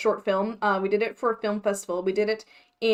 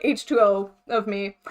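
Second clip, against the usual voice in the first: ordinary talk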